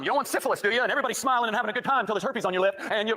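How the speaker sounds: high, fast mocking sermon voice; mocking the preacher's warning; mock fear-mongering preacher. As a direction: ham up a scare-tactic preacher. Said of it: "It was fear-mongering".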